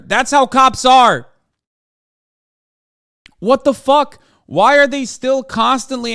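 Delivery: loud, high, exasperated yell; angry outburst. Real, not acted. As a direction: blow up at the screen. Furious rant at the broadcast.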